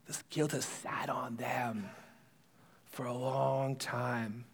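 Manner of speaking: slow, low near-whisper; hushed, weighty. Real, not acted. Preacher dwelling on the brothers' guilt, hushed. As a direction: let each word sit heavily, very soft and slow.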